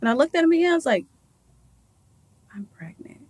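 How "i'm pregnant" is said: very hushed, acted-out disbelief; stunned disbelief. To the doctor on hearing the news, hushed disbelief. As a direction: breathe it out as if barely believing it. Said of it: "Just in disbelief".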